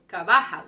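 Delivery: Carvajal mis-stressed as car-VA-hal; loud middle syllable in Carvajal. Shown as a demonstration. Teacher showing another wrong option, middle-syllable stress on Carvajal. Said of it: "instead of Carvajal or".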